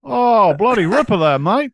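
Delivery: hammed-up Australian, pitched high and loud; Australian accent. Co-host cheering the chart news, in a hammed-up Australian accent. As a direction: lay on a big, mock Aussie accent. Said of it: "my terrible Australian accent".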